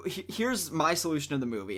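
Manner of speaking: ordinary talk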